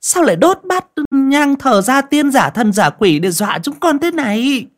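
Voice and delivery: high, mocking daughter-in-law voice; sarcastic. Daughter-in-law mocking her mother-in-law after the fire, high sarcastic voice. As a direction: sneer at the old mother with false sweetness. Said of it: "giọng mỉa mai".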